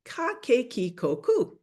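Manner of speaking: "ka, ke, ki, ko, ku" each with a hard K; teacher modelling hard K syllables; syllable demo. Shown as a demonstration. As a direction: spell out "ka, ke, ki, ko, ku" crisply. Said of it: "we want to produce the sound".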